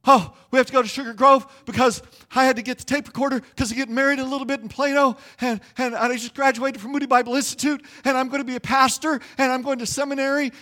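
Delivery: high, fast, breathless rush of words; panicked young man talking fast and high; nervous babbling. Retold or imitated. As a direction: spill it all out to the officer at once. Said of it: "I'm just spilling my guts".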